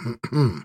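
small, restrained throat clear; polite throat clearing. A narrator's reading. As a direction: clear the throat discreetly for attention. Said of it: "Вежливо прочистили горло у входа". (вежливое покашливание)

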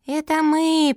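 high, piping small voice; squeaky little turtles. A narrator's reading. Baby turtles announcing themselves to grandma, squeaky. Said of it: "пропищали черепашата".

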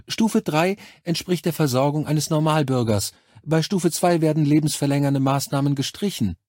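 high, professionally friendly nurse voice; Krankenschwester, berufsmäßig freundlich. A narrator's reading. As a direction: explain cruel rules with unfeeling cheer. (hohe Schwesternstimme, mitleidlos freundlich)

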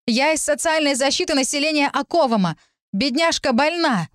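high-pitched public announcement; calling out. A narrator's reading. Official addressing the startled crowd, calling out. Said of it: "я продолжила".

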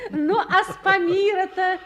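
loud, laughing tease; teasing laugh. Acted. Marya to Nikolai, mocking his Pamir, loud teasing laugh. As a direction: poke fun at him, laughing out loud.

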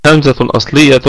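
ordinary delivery

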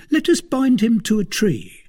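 high-pitched servant voice; servant Planchet, brisk. A narrator's reading. Servant proposes tying up captive, high voice. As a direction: suggest a plan to your master, pitched high.

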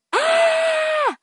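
piercing high scream of horror; horrified scream. A narrator's reading. A mother finding her murdered child, screaming in horror. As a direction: scream as if you just saw a corpse. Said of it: "Một tiếng hét kinh hoàng".

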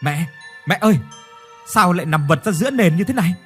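alarmed calling out. Acted. Son finds mother collapsed.

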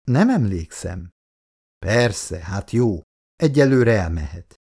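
ordinary delivery

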